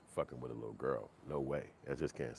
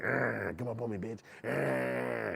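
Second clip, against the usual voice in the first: growling like a caged tiger, higher; 5 semitones higher, 3 dB louder, slower than usual. Pretend. Cornered inmate taunting attackers, growling like a tiger. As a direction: snarl it out like a tiger. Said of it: "turn to a tiger in your cell".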